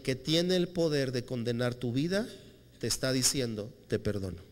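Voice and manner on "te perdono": low, soft and gentle; tender forgiveness. Retold or imitated. Jesus forgiving the woman, low and soft. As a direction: lower and hush it, tender.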